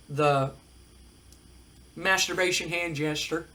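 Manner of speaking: usual speaking voice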